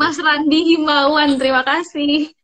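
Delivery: loud, drawn-out thank-you; big, stretched-out shout-out; enthusiastic shout-out. Real, not acted. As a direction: call out a grateful thanks, slow and loud. Thanking viewer who sent question.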